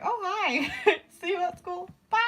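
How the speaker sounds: high, giggly mock greeting; laughing greeting. Imitating her friend's awkward hello, high and giggly. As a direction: chirp it out through laughter.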